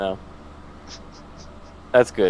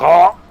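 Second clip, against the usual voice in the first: gasp after gulp; 6 semitones higher, 9 dB louder than usual. Retold or imitated. Downing a drink in one gulp.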